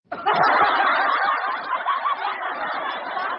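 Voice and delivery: breathless high cackle, no voice; high wheezing laugh. Real, not acted. Laughing hard at his own joke, wheezing high.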